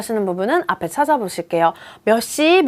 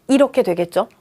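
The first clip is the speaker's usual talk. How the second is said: ordinary talk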